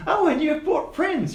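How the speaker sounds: put-on high, squeaky old gnome calling through a door; squeaky gnome behind the door. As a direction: pipe up high like a tiny old man. GM voices Quince.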